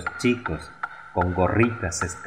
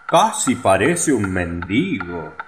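echoing councillors. A narrator's reading. Councillors parroting the mayor in agreement. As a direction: repeat the mayor like yes-men. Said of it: "dijeron los dos consejeros".

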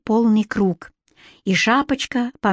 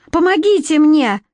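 high, louder cry for help; squealed plea; 7 semitones higher, 5 dB louder than usual. A narrator's reading. Captive queen calls on onlookers, squealed plea. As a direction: squeal urgently for rescue. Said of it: "завизжала Красная Шапочка".

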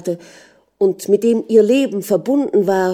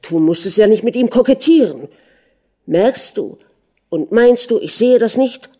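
heated, higher reproach of a jealous husband; accusing; 4 semitones higher, 5 dB louder than usual. A narrator's reading. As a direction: accuse her outright, pitch pushed up. Husband accuses wife of flirting.

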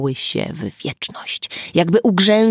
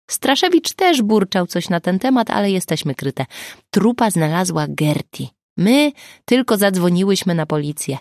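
plain reading voice

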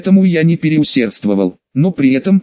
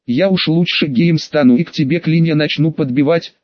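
plain reading voice

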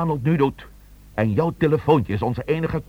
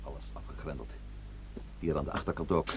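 hushed; 8 semitones lower, 14 dB quieter than usual. Acted. Sneaking around a locked house.

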